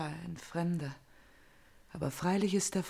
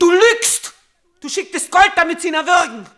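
a much higher, loud shout; furious accusation; 10 semitones higher, 13 dB louder than usual. Acted. Elektra screams at her mother that she lies. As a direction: hurl the charge at her.

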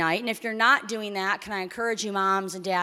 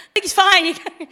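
high, loud, hammed-up insistence; mock emotional outburst; 6 semitones higher, 9 dB louder, faster than usual. Spouse denying hurt feelings, mock emotional outburst. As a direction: wail it as if pretending to be fine.

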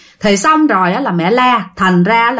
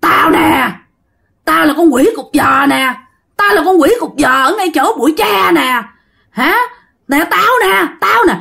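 high, eerie demon voice with a rasp; rasping possessed woman; 6 semitones higher than usual. Acted. Demon in a possessed passenger answering the mother, rasping and eerie. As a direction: announce yourself as a creepy, rasping ghost. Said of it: "nó rồ rồ nghe thấy ghê".

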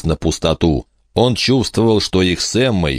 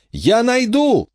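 shouting over wind; slower than usual. A narrator's reading. Michael shouts in storm.

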